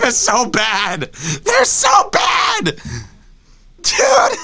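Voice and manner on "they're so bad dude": high, slow, crying-laughing shout; anguished laughing wail. Real, not acted. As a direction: howl in mock agony. Comic despair outburst.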